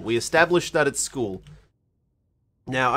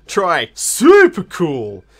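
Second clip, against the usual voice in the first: slow, drawn-out, louder super cool; emphatic upgrade; 6 dB louder, much slower than usual. Acted. Correcting a friend, drawn-out super cool. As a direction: lean hard into super cool.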